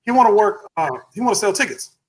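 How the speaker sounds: high-pitched call to the boss; imitated receptionist. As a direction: mimic her announcing a walk-in, pitched well up. Receptionist calling out to the manager.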